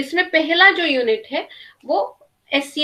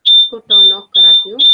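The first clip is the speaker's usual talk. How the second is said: echo; 5 semitones lower, 8 dB louder than usual. Real, not acted. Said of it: "ना, अभी भी हो रहा है।".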